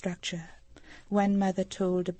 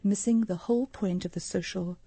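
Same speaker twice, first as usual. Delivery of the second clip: ordinary delivery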